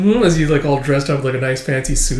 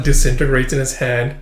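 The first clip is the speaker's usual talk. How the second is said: ordinary delivery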